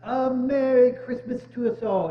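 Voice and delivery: loud, ringing holiday toast; hearty toast. Acted. Bob toasts the whole family, loud and ringing. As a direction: raise the glass and call it out to the family.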